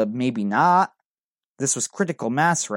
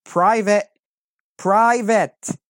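loud, much higher desperate calls; desperate yelling; 9 semitones higher, 7 dB louder, much slower than usual. A narrator's reading. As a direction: yell the word out searching in panic. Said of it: "I started yelling desperately".

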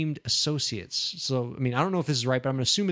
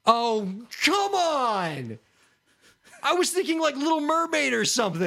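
exasperated protest; 10 semitones higher than usual. Real, not acted. Contestant protests the obscure answer, exasperated. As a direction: wail at the unfairness.

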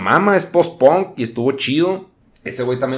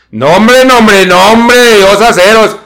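shouting in a higher, different voice; loud put-on voice; 9 semitones higher, 13 dB louder than usual. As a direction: yell it out in a character voice.

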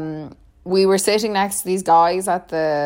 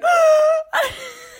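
sharp gasp; 3 dB louder than usual. Real, not acted. Discovering Eleanor exists.

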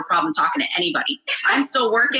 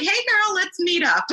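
put-on bubbly girlfriend call; 11 semitones higher than usual.

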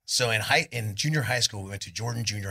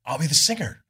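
higher, louder eager volunteer; retold eager offer; 6 semitones higher, 6 dB louder than usual. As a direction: jump in hopefully. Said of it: "I was like".

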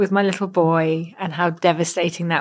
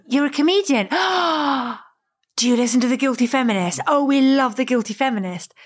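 higher, quick gushing quote with a big gasp; excited school-gate mums; 5 semitones higher, much faster than usual. Retold or imitated. School-gate mum meets a comedian she loves, excited imitation. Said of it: "mums at the school gate".

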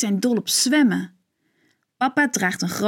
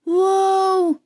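high, bright cry of wonder; amazed wow; 10 semitones higher, 3 dB louder than usual. A narrator's reading. Kids marvel at Ping skating, high amazed cry. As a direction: gasp it out in awe at the skater. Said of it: "roepen Joep en Evelientje".

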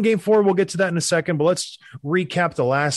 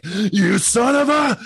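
higher, slow, strangled mock rage, cut off mid-insult; mock furious outburst; 6 semitones higher, much slower than usual. Host imitating the coach snapping at a player, mock fury. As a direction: spit it out like a coach losing it. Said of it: "And he's like".

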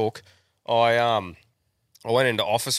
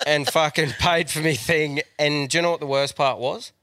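usual speaking voice

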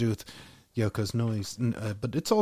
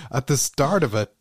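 ordinary talk, nothing marked

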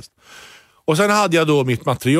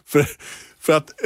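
ordinary talk

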